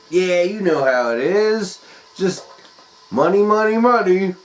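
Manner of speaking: high, loud money money money; boastful rich guy. Retold or imitated. Jim bragging to the sitter about gambling, loud and high. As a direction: brag about the cash.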